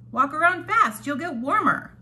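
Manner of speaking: upbeat recess-teacher encouragement; encouraging call. Retold or imitated. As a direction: cheer shivering kids onward outdoors. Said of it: "I would encourage them".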